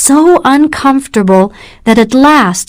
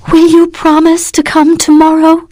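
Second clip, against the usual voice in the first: put-on higher, urgent young woman's plea; anxious pleading; 8 semitones higher than usual. A narrator's reading. Catherine urging Morris to promise, anxious. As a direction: ask as if afraid of the answer. Catherine voiced in read story.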